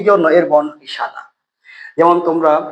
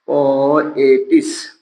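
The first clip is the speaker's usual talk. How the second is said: slow syllable drill; 3 semitones lower, much slower than usual. Shown as a demonstration. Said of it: "teacher drills syllables".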